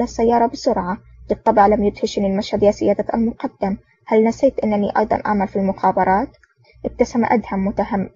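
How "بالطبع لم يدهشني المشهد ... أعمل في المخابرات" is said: plain reading voice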